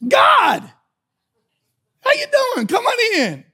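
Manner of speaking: very high, hammed-up happy welcome; delighted greeting. Preacher in his heavenly mansion greeting God, delighted. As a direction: greet a surprise guest in a squealed high voice.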